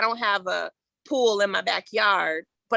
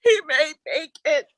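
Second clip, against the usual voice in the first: laughing; 4 semitones higher, 4 dB louder than usual. Real, not acted. Laughs through her own story.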